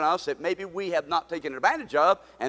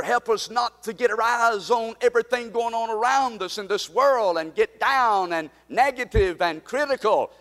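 ordinary talk

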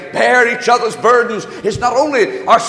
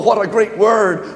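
ordinary talk

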